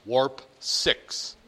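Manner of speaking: low, lightly acted starship order; starship command voice. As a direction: give a mock bridge command. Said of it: "behind you a voice say".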